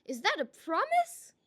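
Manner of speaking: high, eager mock hope. A narrator's reading. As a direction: sound thrilled at the threat. Malice feigns eager hope.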